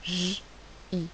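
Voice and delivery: "J" as a held buzzing consonant, then "I" alone; isolated phonics sounds "J, I"; separate sounds. Shown as a demonstration. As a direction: sound out "J, I" with a pause between. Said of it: "trouver deux sons".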